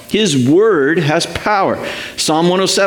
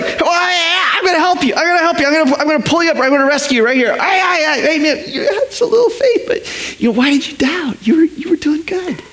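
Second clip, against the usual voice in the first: much higher, laughing friendly rescuer; Jesus voice; 11 semitones higher, faster than usual. Acted. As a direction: play Jesus cheerfully pulling him up. Preacher voicing Jesus helping Peter.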